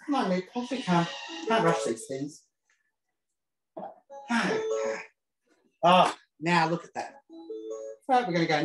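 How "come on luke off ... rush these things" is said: ordinary talk, nothing marked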